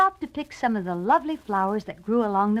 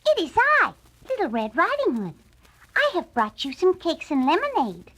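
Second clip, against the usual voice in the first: put-on higher, bright child voice; little girl voice; 8 semitones higher than usual. A narrator's reading. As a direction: sound like a young girl.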